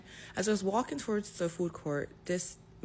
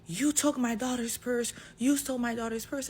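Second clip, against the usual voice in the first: retold yell; 8 semitones higher, 4 dB louder than usual. Angry father accusing a young woman at the mall, mock yell. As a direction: imitate him barking the accusation, half-hearted. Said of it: "he is yelling at me saying".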